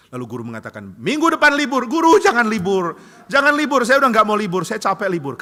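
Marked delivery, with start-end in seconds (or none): 1.0-1.9 called out high and loud; teacher teasing the pupil about the holiday; sing-song announcement. Acted. 1.9-5.4 loud, high begging; boy begging his teacher not to cancel; desperate pleading. Acted. Child begs teacher no holiday.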